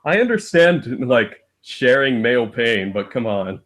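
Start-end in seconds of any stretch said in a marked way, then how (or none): none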